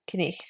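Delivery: "kneich" with the K sounded and a soft throaty ch at the end; Middle English night. Shown as a demonstration. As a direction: sound the K, then breathe a light ch. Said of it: "but it is pronounced nonetheless".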